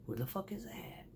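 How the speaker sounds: hushed whispered question; whispered to self. Retold or imitated. Driver whispering to herself following the mixer. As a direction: whisper it to yourself behind the wheel. Muttering to herself while driving.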